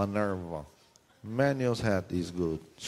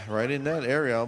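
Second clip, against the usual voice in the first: ordinary talk, nothing marked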